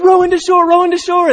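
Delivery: very high, loud urgent calls; frantic shout. Acted. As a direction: yell at the crew to row. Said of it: "they're like".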